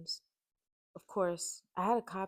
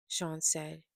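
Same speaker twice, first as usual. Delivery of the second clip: ordinary delivery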